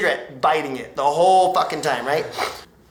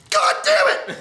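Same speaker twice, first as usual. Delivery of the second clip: very high, strangled angry shout; mock furious cop. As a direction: explode in comic outrage. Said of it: "He was so fucking mad".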